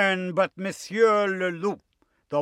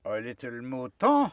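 charming wolf; 5 semitones lower than usual. Acted. Wolf at the sheep's door, charming singsong. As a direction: sing it out sweetly and low. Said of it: "sang out in his most charming voice".

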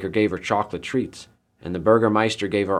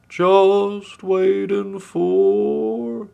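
high, loud, stretched-out sing-song; idle pig; 10 semitones higher, 5 dB louder, much slower than usual. A narrator's reading. Pig innocently passing time on the bridge, drawn-out high voice. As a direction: draw every vowel out slowly and high.

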